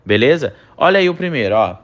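ordinary talk, nothing marked

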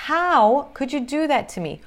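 usual speaking voice

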